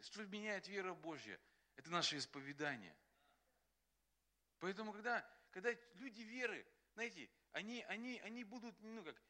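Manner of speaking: usual speaking voice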